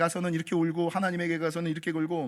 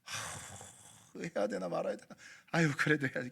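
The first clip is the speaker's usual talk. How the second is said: whispered, breathy despair; hushed, tearful hesitation; weeping sigh; 3 semitones lower, 6 dB quieter than usual. Retold or imitated. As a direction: sigh the words out almost voiceless. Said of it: "막 울면서".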